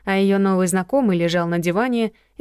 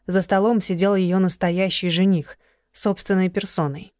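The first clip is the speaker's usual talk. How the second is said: usual speaking voice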